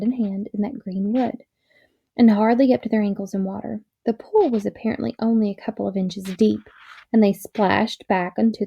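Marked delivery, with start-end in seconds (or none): none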